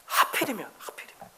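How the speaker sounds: soft whispered echo. Acted. Preacher letting the key word linger, whispered. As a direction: breathe the word as a hushed afterthought.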